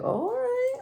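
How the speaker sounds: high, soft, casual "oh, all right"; mock breezy reply. Answering her ex's text, high and breezy.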